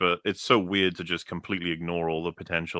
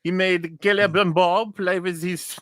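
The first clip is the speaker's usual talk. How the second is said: high, slow mock French accent; 11 semitones higher, 4 dB louder than usual. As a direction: camp it up like a hammy Frenchman. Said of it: "Slash read in fresh, uh, French accent".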